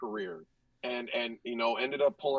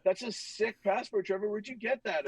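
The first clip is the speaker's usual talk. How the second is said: mock admiration; 7 semitones higher than usual. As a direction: gush sarcastically like a needling teammate. Teammates taunting him over passport.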